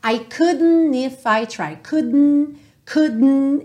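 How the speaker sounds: "couldn't" with the D stopped in the throat; swallowed D. Shown as a demonstration. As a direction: cut "couldn't" short, sound pulled inward. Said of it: "É como se fosse um som pra dentro".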